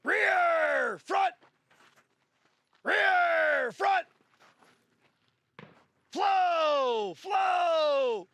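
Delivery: loud high commands to the class; shouted drill calls. Real, not acted. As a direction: call each position out hard. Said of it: "calling drill positions".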